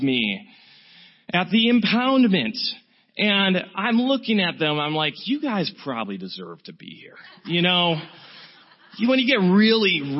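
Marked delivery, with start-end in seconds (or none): 5.3-7.2 low, quiet smug judgment; a hint of holier-than-thou scorn; self-righteous. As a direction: mutter it like you're above them all. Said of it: "really self-righteous".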